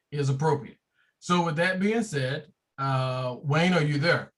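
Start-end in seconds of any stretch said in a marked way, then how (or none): none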